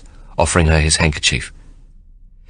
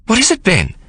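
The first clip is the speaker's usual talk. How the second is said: much higher, sharp worried question; alarmed. A narrator's reading. Sarah startled by Ben's panic, alarmed question. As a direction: ask as if something has gone wrong. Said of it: "Sarah asks, alarmed".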